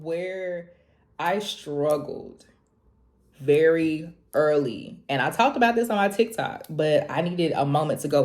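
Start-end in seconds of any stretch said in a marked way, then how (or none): none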